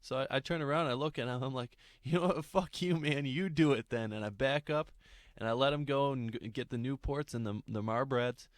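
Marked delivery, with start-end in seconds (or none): 2.0-4.1 mock exasperated outburst, higher and louder; retold angry comeback; fed-up retort. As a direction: throw the job back at him, fed up. Acted fed-up outburst at robber.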